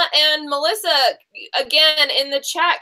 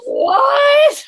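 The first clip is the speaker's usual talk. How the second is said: high shocked shout; a stunned, outraged what; mock disbelieving yell; 12 semitones higher, 5 dB louder than usual. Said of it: "and I was like".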